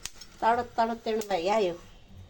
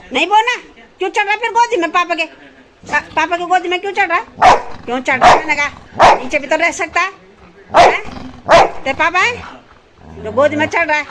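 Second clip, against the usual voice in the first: real angry scolding; 8 semitones higher, 11 dB louder than usual. Real, not acted. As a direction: shout him down for climbing on papa's lap. Scolding child climbing on papa.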